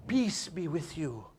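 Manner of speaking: soft whispered blessing; whispered greeting. Shown as a demonstration. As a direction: breathe it out as a blessing. Greeting said tenderly.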